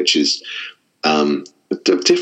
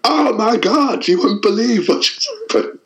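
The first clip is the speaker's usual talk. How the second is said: acted astonishment, pitched way up; excited outburst. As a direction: re-enact gushing breathlessly to your wife. Retold excited outburst to his wife.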